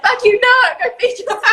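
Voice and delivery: high, laughing imitation of the coach; mock tough coach. Host quoting the coach to her, high laughing mock bark. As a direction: throw the line back at her, laughing. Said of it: "And you're like".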